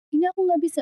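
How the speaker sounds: high-pitched mock child protest; imitated complaining child. Child tells parent they can't manage, high imitated protest.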